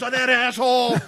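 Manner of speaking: high, loud mock outrage; retold indignation. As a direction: imitate an indignant brother cursing the prankster. Brother's outrage at the prankster.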